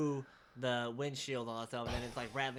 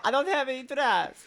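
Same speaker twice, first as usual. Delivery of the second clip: hammy Russian; 12 semitones higher, 11 dB louder than usual. Acted. Continuing the hammy Russian bit.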